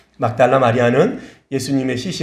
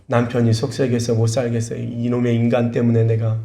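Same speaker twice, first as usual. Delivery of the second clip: usual speaking voice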